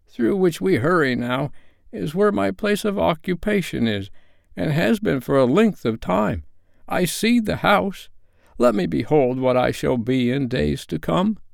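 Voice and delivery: high-pitched, pleading Scrooge; earnest Scrooge. A narrator's reading. Scrooge asks the spirit to show his future, earnest.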